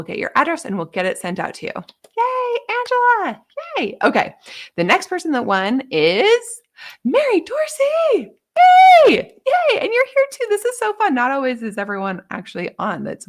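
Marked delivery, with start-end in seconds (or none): 2.2-4.0 much higher, slow, drawn-out yay; delighted high cheer. Real, not acted. Cheering the winner. 7.0-11.1 excited shout. Real, not acted. Announcing a giveaway winner.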